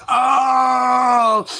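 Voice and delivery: mock moment of revelation; acted sudden realization. Adam's sudden realization after bite.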